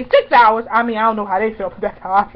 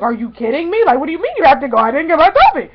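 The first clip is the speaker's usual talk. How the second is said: louder, higher mock protest; upset, retold; 4 semitones higher, 6 dB louder than usual. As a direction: act out being let down, building to a loud complaint. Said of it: "sad because I was like".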